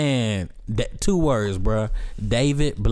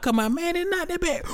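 high, softer pleading voice; mock movie defenders; 4 dB quieter than usual. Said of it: "that's what everybody else is doing".

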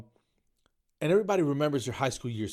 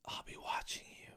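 creepy whisper; 13 dB quieter than usual. Acted. Saying [name]'s lyrics without singing, creepy whisper. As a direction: whisper it like a menacing stalker. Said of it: "That's just creepy".

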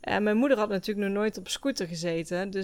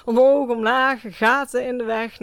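loud laughing, louder and higher; 4 semitones higher, 9 dB louder than usual. Real, not acted. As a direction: laugh through the bumpy words. Laughing recounting bumpy road.

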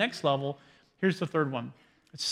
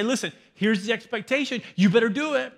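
louder, mock-stern warning; mock stern parent; 5 semitones higher, 4 dB louder than usual. Parent laying down expectations to a child, in a louder mock-stern warning. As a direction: lay down the law like a strict parent.

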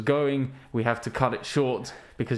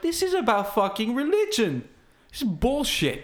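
higher, indignant disbelief; annoyed outburst; 9 semitones higher than usual. Retold or imitated. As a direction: scoff at it like a betrayed fan. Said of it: "and I was like".

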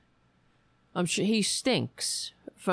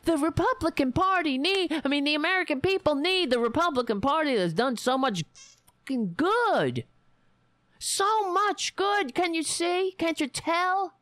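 sarcastic mockery; 6 semitones higher than usual. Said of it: "all I keep getting back is".

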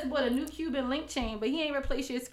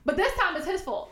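ordinary talk, nothing marked

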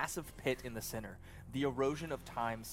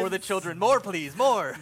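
shouting for more; rowdy chant; loud cheering; 7 semitones higher, 15 dB louder than usual. Real, not acted. Excited table cheering.

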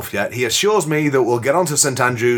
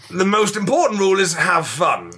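usual speaking voice

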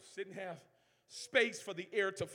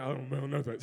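son's deep voice; 6 semitones lower than usual. Retold or imitated. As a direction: drop low, like the son who doesn't care. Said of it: "His voice is deep now".

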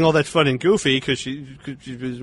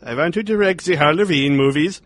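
put-on French-Swedish accent; 4 semitones higher, 3 dB louder than usual. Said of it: "formulate a French and Swedish accent".

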